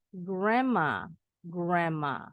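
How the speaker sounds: Grandma as "gramma"; Grandma with D and N gone; silent D. Shown as a demonstration. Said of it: "berubah bunyi jadi M".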